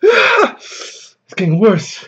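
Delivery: strained. Real, not acted. Pain from spicy food.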